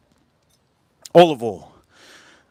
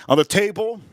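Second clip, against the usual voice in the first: ordinary delivery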